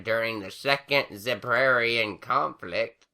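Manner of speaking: put-on high, slow old crone voice; slow old granny reminiscing. A narrator's reading. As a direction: ramble fondly like an old mare. Granny Smith figure voice.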